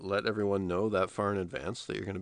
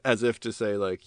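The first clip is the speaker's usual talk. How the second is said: normal talking voice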